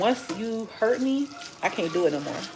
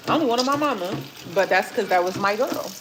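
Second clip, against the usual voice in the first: ordinary delivery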